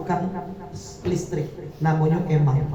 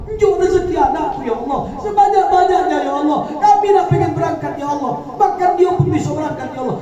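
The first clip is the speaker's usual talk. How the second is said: high, loud pleading prayer; 12 semitones higher, 5 dB louder than usual. Acted. Mother praying to Allah for her children, high and pleading. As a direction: beg God as if near tears. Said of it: "minta sama Allah".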